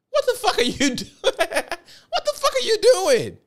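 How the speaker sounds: very high and slow, laughing through it; stunned, half-laughing at your friend; laughing disbelief. Retold or imitated. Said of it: "I'm looking at him like".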